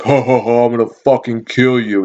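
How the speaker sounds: imitated unhinged laugh into a threat; mock maniac threatening; insane threat. Huffing customer yelling at young coworker, mock-insane threat. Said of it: "He just sounded insane".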